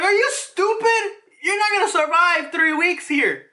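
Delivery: high-pitched exasperated rebuke; incredulous scolding. Acted. Ivankov scolding a hopeful old man, incredulous. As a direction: scold in high, strained disbelief.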